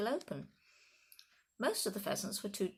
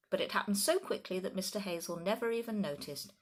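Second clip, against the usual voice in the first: plain reading voice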